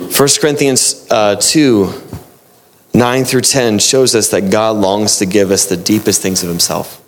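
normal talking voice

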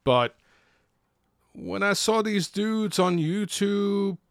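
normal talking voice

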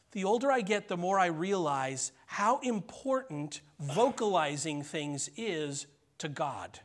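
ordinary talk, nothing marked

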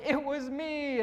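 high-pitched, worked-up cartoon admission; Junior. Pastor voicing cartoon Junior confessing, high and worked up. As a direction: own up in a high, overwrought voice. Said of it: "worked up".

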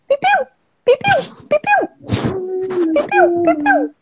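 high cartoon bird chirping.

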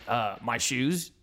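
slow, low, unimpressed answer; imitated child's "duh" reply; kid stating the obvious. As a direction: answer as if the question is stupid. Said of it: "he's acting like".

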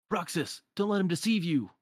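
high-pitched mock yell; pleading shout, lightly acted. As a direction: call out urgently without much added volume. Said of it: "they just keep yelling his name".

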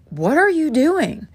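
acted-out "what do you think you're up to" tone; retold challenge. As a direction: question him as if you can't believe it. Acted confrontation over the bag.

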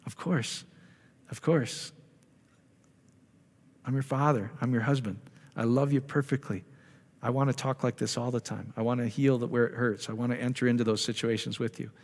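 put-on gentle, hushed voice of God reassuring a child; tender, loving reassurance. God answering someone who asks to hear Him, tender and soft. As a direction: speak softly and unhurriedly, like a loving father.

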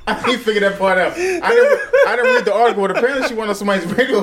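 high, breaking into laughter; can't stop laughing mid-sentence. Real, not acted. Laughing while telling story.

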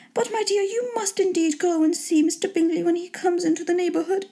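high-pitched lady urging the husband; put-on high, insistent wife voice; pleading wife. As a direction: pitch it way up and press the point.

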